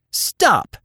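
high, urgent cry; sharp high-pitched yell; cried out. A narrator's reading. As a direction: shout a halt to the men. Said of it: "she cried out again".

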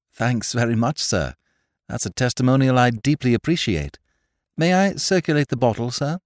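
lightly voiced courteous gentleman; keen younger gentleman. A narrator's reading. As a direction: thank him with polite eagerness.

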